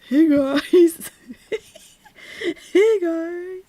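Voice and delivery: high, drawn-out mock greeting; mocking the streamer. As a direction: call out in a put-on high voice. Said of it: "what was she gonna say".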